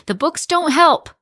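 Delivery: loud, high burst of frustration; inner shout. A narrator's reading. Teacher bursting out at the principal, loud and high. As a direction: shout it out in protest. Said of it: "inside I want to shout".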